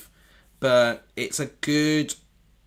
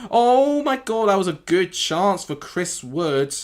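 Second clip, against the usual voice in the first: excited; 9 semitones higher, 7 dB louder, slower than usual. Real, not acted. Near miss for Forest.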